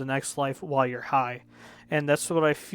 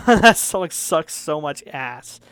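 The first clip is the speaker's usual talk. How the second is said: loud, slow rant; emphatic disgust; 7 dB louder, slower than usual. Real, not acted. Reviewer trashing a track he hates, loud slow disgust. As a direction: drag it out loudly in disgust. Ranting about a hated song.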